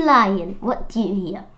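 usual speaking voice

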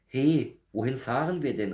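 high, loud alarmed question; anxious call. A narrator's reading. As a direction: call out like a worried little bear. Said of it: "rief er ängstlich". (ängstliches, hohes Rufen)

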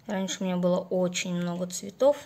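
usual speaking voice

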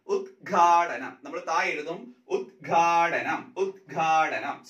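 ഉദ്ഘാടനം said very slowly as ഉദ്-ഘാ-ട-നം with a clear ദ്ഘ; syllable by syllable ഉദ്ഘാടനം, ഘ held clearly; slow spelling demo. Shown as a demonstration. Teacher to exam students, slow clear ഉദ്ഘാടനം. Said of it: "ശ്രദ്ധിച്ചോണം".

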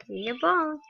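ordinary talk

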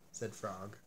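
plain reading voice

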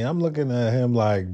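ordinary talk, nothing marked

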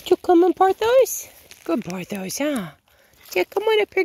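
pet talk. Real, not acted. Feeding oats to her chickens, very high cooing pet talk. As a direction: coo at them like they're babies.

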